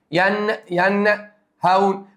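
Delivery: ينهون drawn out very slowly, nun pronounced clearly. Shown as a demonstration. Teacher slowing down for students: ينهون, nun clearly sounded. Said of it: "النون ظهرت هنا".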